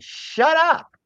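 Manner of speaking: very high, sharp mock brush-off; mocking a CEO waving the whining away; dismissive. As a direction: cut them off with a high scoff. Dismissing the whiners.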